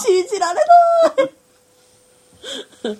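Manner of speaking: high, laughing disbelief; shocked auntie. Pretend. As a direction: gasp like a scandalized auntie. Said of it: "「あら、やだー」とか".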